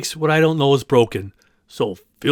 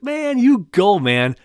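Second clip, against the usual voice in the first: mock cheer; 8 semitones higher, slower than usual. Cheering on young man, retold.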